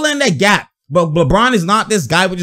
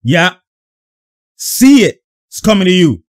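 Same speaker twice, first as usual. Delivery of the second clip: mock on-court instruction, slightly raised; calling to teammate; 5 dB louder than usual. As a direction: voice a player alerting his teammate. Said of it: "he's about to tell".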